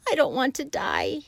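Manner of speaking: a moaning, high plea. A narrator's reading. Wilbur bursts into tears, moaning high. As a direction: moan it through tears. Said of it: "he moaned".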